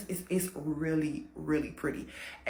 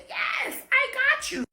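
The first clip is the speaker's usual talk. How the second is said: mock sweet-talker; 3 dB louder, slower than usual. Man answers mermaid in story.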